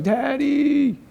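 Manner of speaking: high wailing Daddy; imitated crying call. Father recalls daughter crying after him, high wail. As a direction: call out like a crying little girl.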